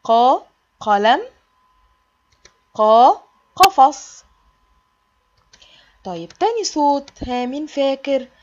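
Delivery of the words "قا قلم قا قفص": قا then قلم, قفص said slow and loud, first syllable stretched; sounding out qa. Shown as a demonstration. Teacher showing kids the قا in words, slow and loud. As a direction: pull out the قا before each word. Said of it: "زي كلمة".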